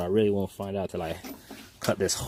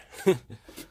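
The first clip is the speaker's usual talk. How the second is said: short laugh; 6 semitones higher than usual. Real, not acted. Laughing at rat skeleton find.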